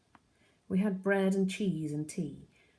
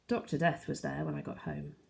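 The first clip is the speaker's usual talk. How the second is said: usual speaking voice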